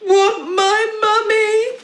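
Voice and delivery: put-on high loud toddler bellow; bellowing baby. Baby bellows refusing to go to dad. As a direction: bawl it like a stubborn baby.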